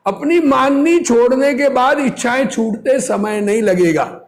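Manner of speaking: ordinary delivery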